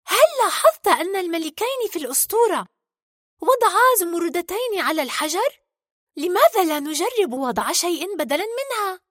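put-on high-pitched storybook girl voice; eager heroine with an idea. Merida recalls the legend and proposes a try, eager. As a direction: pitch it up, suggesting a plan to a companion.

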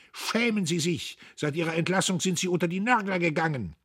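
high-pitched scolding cry; indignant shout. A narrator's reading. Dietrich berates his opponent face to face, shouting indignantly. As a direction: shame him loudly to his face. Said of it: "schrie er Södbier ins Gesicht".

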